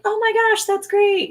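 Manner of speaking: high mock-excited gush; lightly voiced husband overdoing the delight; playful impression of him; imitating husband. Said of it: "He's gonna be like".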